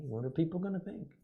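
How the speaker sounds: high-pitched nagging dad voice; imitating worried dad. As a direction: fret like a dad worried about appearances. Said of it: "I used to hear my dad talk".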